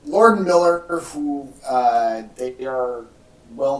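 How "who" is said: ordinary talk, nothing marked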